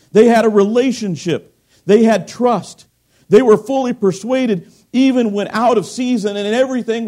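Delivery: preaching. Acted. Preacher to congregation, emphatic preaching. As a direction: hammer each short claim home.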